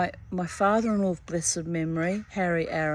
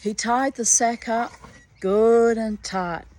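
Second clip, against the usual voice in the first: usual speaking voice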